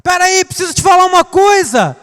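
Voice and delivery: put-on high, loud urgent child calling his dad. An impatient child interrupting his father, loud high calling. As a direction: yell for Dad like an impatient kid. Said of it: "Ou você chegava assim".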